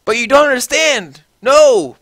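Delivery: high, loud frantic begging; panicked cries of no, no; desperate pleading. A narrator's reading. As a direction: plead with him not to leave. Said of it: "pleaded Draco".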